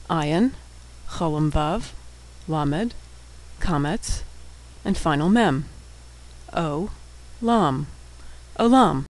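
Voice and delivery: letter by letter, then syllable by syllable; sounding out. Shown as a demonstration. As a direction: spell it out with pauses, then say the whole word. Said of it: "we'll be sounding out all of the words".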